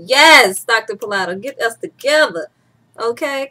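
loud opening, then sassy urging; hammed-up, emphatic approval; mock emphatic agreement. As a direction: cheer the doctor on, played for laughs. Acted emphatic reply to doctor.

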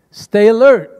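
loud, punchy command; with conviction. Acted. Pastor commanding the church like soldiers, loud. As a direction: bark it at the troops. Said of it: "say it with conviction".

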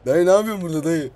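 fast, high and loud alarmed question; spooked shout at a stranger, fast; startled shout. Real, not acted. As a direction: blurt it out in fright. Meets a strange figure.

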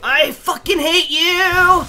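high, loud whining rage; exasperated yell. Real, not acted. Player who hates this enemy, exasperated high yell. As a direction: yell it high at the thing you hate.

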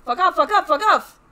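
loud, repeated angry retorts; heated repeated fuck off; angry yelling. Real, not acted. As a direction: yell it back at the game. Arguing with the game character.

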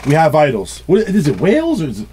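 normal talking voice

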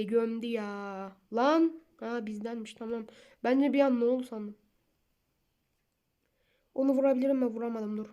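ordinary delivery

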